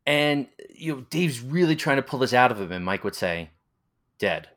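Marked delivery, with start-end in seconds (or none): 4.2-4.5 low, undramatic one-word delivery; deadpan refusal. Retold or imitated. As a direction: imitate someone who won't play along. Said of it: "he wouldn't play the game".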